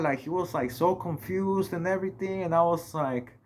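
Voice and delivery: ordinary talk, nothing marked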